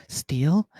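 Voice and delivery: questioning. Acted. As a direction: ask it like a puzzled eerie child. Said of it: "the red voice kind of go".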